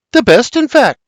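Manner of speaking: proud high boast; crowing. A narrator's reading. Mary boasting to her father about the hunt, crowing. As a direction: crow about the good hunt. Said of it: "Mary crows".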